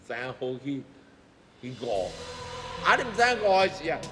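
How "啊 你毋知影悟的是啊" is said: ordinary delivery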